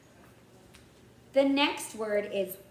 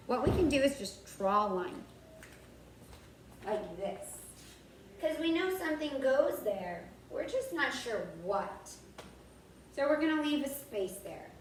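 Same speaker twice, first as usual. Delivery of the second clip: ordinary talk, nothing marked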